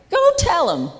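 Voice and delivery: high, loud Southern holler; Southern accent. Retold or imitated. Divine voice sending a child back, in a Southern accent. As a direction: send them off in an imitated Southern accent. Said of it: "he said it with a Southern accent".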